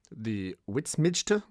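slow, unsure witsmidgte with a questioning rise; mangled Dutch dish. Retold or imitated. Ordering at the Dutch cafe, fumbling the dish name. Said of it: "I'd point to the menu and I'd say".

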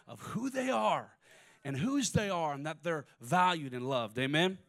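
rising preacher's call; full-force preaching. Acted. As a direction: preach it out to the congregation. Preaching builds to climax.

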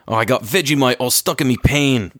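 hammed-up mock Aussie; exaggerated Australian for laughs; Australian accent. Shown as a demonstration. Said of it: "You want me to be Australian".

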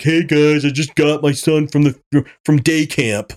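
a hint of a breezy sitcom dad dropping by; mock Hulk dad. Ranting podcaster mocks Hulk arriving with his son, in a light goofy dad voice. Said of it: "your son shows up with you like".

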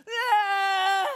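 high, loud mock sobbing wail; ugly cry wail. Preacher imitating an ugly cry, mock wailing. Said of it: "I'm talking sobbing".

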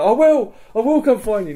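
very high, loud plaintive promise; departing captive. Retold or imitated. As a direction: call back imitating a man dragged away. Voices captive calling while led away.

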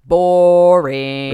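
long, loud, drawn-out mock boredom; imitated bored drone; bored dismissal. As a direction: stretch it out like a mock complaint. Voicing bored people, drawn out.

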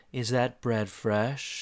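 very slow, soft delivery; imitated store flirt. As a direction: draw it out coyly and softly.

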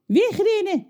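smothered, muffled voice. A narrator's reading. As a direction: speak as if squashed under an arm. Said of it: "zegt John met gesmoorde stem".

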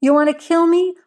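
high, rising dare; defiant challenge. A narrator's reading. Wife with knife faces husband's rifle, high defiant challenge. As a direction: pitch it up and dare him.

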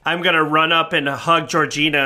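ordinary talk, nothing marked

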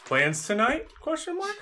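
put-on high questioning girl voice; man doing a woman's voice. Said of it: "[name] to read the girl voice".

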